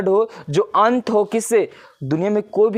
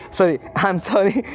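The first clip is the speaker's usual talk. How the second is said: amused, drawn-out apology with a chuckle; laughing apology; slower than usual. Real, not acted. Teacher catching a slip mid-explanation, laughing apology. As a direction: apologise through a small laugh.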